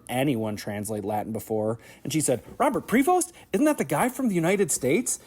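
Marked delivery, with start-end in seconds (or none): 2.6-5.2 high-pitched, incredulous questioning in imitation of a colleague; astonished colleague. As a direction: imitate her amazed double-take, pitch leaping up. Colleague reacts to pope's name.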